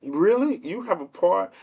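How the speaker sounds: high-pitched mock disbelief; incredulous retort. Streamer answering election conspiracy theorists, incredulous. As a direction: question it in high, exaggerated disbelief. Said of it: "And I'd be like".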